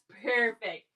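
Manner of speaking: ordinary delivery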